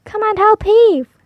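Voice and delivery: high, loud call to heave; calling for help. Acted. Boy calling a girl to help pull. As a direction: shout for help across the garden. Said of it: "called the boy to a girl".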